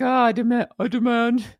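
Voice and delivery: lightly mocking Prince Harry's demands; a hint of pompous prince. Mocking Harry demanding things of the police, lightly pompous. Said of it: "I can't do his accent".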